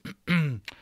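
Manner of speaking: hesitant throat-clearing. A narrator's reading. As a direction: clear your throat before speaking up. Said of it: "cleared his throat".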